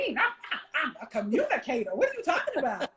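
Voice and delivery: laughing, indignant self-defense; defensive. Retold or imitated. Wife mocking her own defensive answer, laughing and indignant. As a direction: insist you're a great communicator. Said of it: "Look, defensive".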